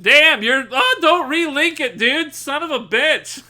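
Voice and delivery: loud, high, exasperated yell at chat; shouting at a chatter, half laughing; real yell. Real, not acted. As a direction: yell it at the guy spamming links. Streamer yells at chatter relinking.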